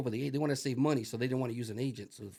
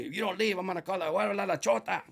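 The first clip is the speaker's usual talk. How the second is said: higher, louder imitated owner; mock threat; 5 semitones higher, 3 dB louder than usual. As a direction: stammer the threat in a lightly put-on voice. Owner threatens to call police.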